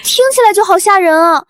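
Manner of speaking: high, tearful helpless act; whimpering mock fear; feigned fright. 我害怕.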